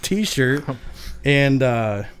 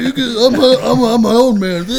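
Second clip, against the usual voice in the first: high, loud mock drunken rant; a hammed-up drunk proclaiming himself; drunk angry outburst; 10 semitones higher, 8 dB louder than usual. Drunk guy ripping his shirt at his wife, hammed-up outburst. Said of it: "I'm all pissed off".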